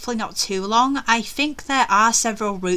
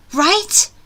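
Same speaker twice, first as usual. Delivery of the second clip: RIGHT high, loud and rising; acted rising panic; anxious question; 9 semitones higher, 7 dB louder than usual. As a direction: push RIGHT into worried insistence. Heroine seeking reassurance, more urgently.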